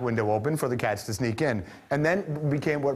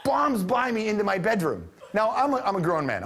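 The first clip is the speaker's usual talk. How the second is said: ordinary delivery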